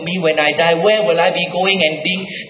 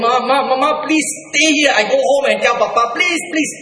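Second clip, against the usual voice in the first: put-on pleading child; 8 semitones higher than usual. Boy begging his mother to stay, high and pleading. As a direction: beg your mother to stay, like a scared boy.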